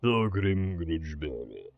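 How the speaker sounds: low, slow epic voice; deep dramatic announcer. Pretend. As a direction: intone it like a movie trailer. Dramatic unit name reading.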